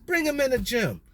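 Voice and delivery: a hint of a surprised scout sizing up a tall kid; imitating a coach. Coach spotting a tall fourteen-year-old, mock surprised. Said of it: "a coach walked by".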